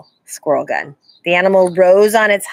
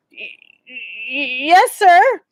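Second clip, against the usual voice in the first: stammering fright; 7 semitones higher, 4 dB louder, much slower than usual. A narrator's reading. Scared boy stammers.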